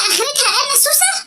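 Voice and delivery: hurt disbelief, rising at the end; mother offended at being called sneaky; mock outrage. Indignant repeat of insult.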